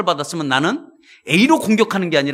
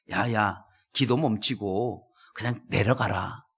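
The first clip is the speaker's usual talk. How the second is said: low, soft tempting devil voice; 8 semitones lower, 7 dB quieter, slower than usual. Acted. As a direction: coax him down like a sly devil. (낮고 조용하게 유혹하는 마귀 목소리)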